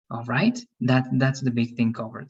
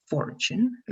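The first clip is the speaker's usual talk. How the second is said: "fortune" as FOR-chun, ending on a rise; corrected word stress; 9 semitones higher than usual. Shown as a demonstration. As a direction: repeat "fortune" stressed on the first syllable. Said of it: "but the stress. Fortune".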